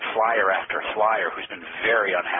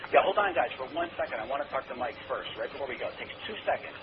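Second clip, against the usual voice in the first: much softer aside, as if turned away from the phone; fake side conversation; 4 semitones higher, 7 dB quieter, faster than usual. Shown as a demonstration. Caller demoing a made-up conversation to pressure the gatekeeper, softly off-mic. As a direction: hush the imaginary room before the call. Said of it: "Here's what it sounds like".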